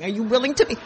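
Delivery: ordinary delivery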